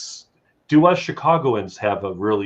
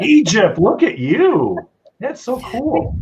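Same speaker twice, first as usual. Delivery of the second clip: excited delight; 11 semitones higher, 4 dB louder, slower than usual. Real, not acted. Host delighted by guest's answer.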